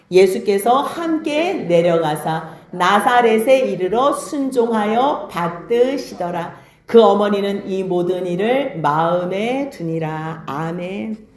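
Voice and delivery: slow, measured reading aloud; scripture reading. A narrator's reading. Preacher leads congregation through the verse, slow formal scripture reading. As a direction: read the verse solemnly with drawn-out phrases.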